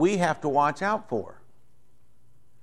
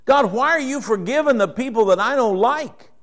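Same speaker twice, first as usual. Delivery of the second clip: higher, whiny protest to God; indignant complaint; 8 semitones higher, 3 dB louder than usual. Retold or imitated. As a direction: complain like a sulking child. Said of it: "He's angry that God's so gracious".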